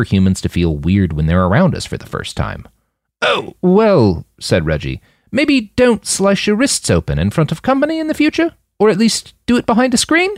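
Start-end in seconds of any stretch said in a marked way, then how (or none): 3.2-4.3 lightly raised voice for the Brit Reggie; a hint of a prim, higher British journalist; Reggie's voice. The journalist answering Mike, a touch higher. 5.3-10.3 lightly raised voice for the Brit Reggie; a hint of a prim, higher British journalist; Reggie's voice. The journalist answering Mike, a touch higher.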